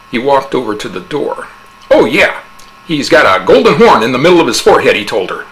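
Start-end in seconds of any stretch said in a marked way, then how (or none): 1.9-5.0 higher, louder, fast teasing addition; eager taunt. A narrator's reading. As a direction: toss it back cheerily. Husband adds detail, taunting.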